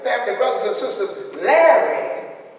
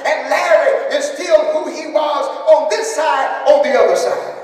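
loud, higher preacher's call, swelling on Abraham Isaac Jacob; preaching at full force; 5 semitones higher, 3 dB louder than usual. Real, not acted. Pastor assuring the grieving family, rousing preaching. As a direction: carry the church on each line.

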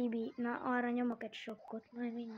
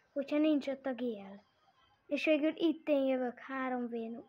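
ordinary talk, nothing marked

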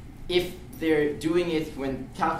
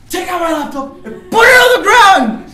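very high, loud, slow barked order; full-throated shouted command; 15 dB louder than usual. Retold or imitated. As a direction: yell it like an ultimatum. Yelling demand at thief.

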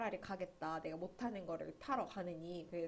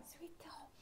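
whispered aside; 8 semitones higher, 14 dB quieter than usual. Real, not acted. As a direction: whisper it, very soft and voiceless. 드라마 제목을 슬쩍 속삭임. (작게 속삭이듯)

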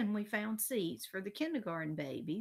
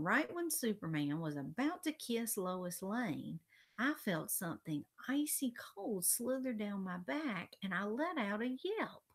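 ordinary delivery, nothing marked

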